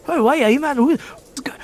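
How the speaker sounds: high-pitched Geordie dwarf; Geordie accent. Acted. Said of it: "Geordie style of".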